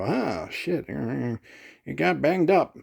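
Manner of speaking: slow, offhand fake dismay; mock careless shrug. Picturing a gig with a caseless Squier, mock careless and slow. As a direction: play it like you barely care the guitar's dinged. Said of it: "like, kinda go".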